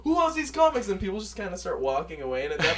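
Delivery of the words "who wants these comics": high, loud street-seller patter; pitchman shout. Retold or imitated. Tyrese hawking comics to the crowd, loud pitchman call. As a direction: hawk comics to a leaving crowd. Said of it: "It's like".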